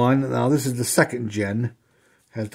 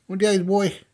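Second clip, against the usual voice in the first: talking to a dog; 6 semitones higher than usual. Real, not acted. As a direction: coo at the pet. Talking sweetly to his dog.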